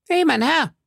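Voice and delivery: put-on very high, boyish shout after someone; stable boy calling. A narrator's reading. Stable boy runs after the unknown rider, calling high. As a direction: call after him in a high lad's voice. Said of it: "rief ein Stalljunge".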